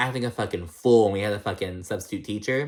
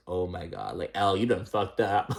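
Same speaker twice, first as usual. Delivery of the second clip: put-on stunned kid, low; 3 semitones lower, 4 dB quieter than usual. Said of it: "he's just like".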